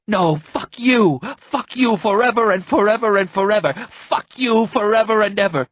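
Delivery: put-on high, loud childish tantrum; yelling like a kid. A narrator's reading. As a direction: scream it like a little kid. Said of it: "He was yelling like a little kid".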